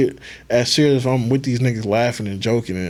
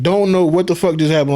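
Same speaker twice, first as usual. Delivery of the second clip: ordinary delivery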